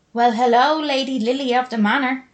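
put-on loud, high yell in thick Dublin accent; yelled North Side Dublin. A narrator's reading. May calling up to her sister at the window, Dublin yell. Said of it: "she yelled in her thick North Side Dublin accent".

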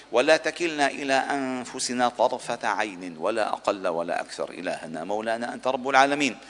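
normal talking voice

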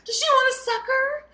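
high, loud coaxing waitress voice; high-pitched mock sweet offer; fussing waitress. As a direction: imitate a waitress cooing an offer to an upset child. Retelling waitresses soothing a crying child.

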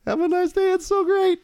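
high put-on voice. Mimicking the robot's line.

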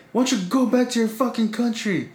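high-pitched imitation of an irate woman; retold angry outburst. Lady in the car insults a teenager, high mock-angry voice.

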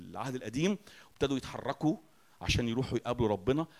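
ordinary talk

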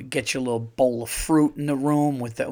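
ordinary talk, nothing marked

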